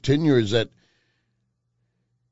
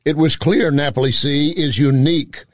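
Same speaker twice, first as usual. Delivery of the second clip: live ad read; 3 semitones higher, 3 dB louder than usual. Acted. Host reads sponsor endorsement.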